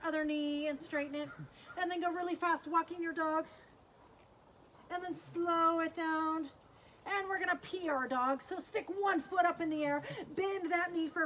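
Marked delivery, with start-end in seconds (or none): 4.9-6.5 high, long stretched SLOW; slowing right down. Pretend. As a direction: ease the kids down with drawn-out words. Voice mimics slowing dog walk.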